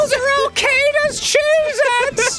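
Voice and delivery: high, drawn-out shriek; hammed-up alarmed crowd scream; mock frantic warning. Joins acted crowd warning.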